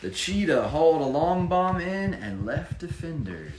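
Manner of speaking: picture-book narration rising to the big play; rhyming story reading. A narrator's reading. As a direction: build the tension of the comeback for a child. Reads rhyming story aloud.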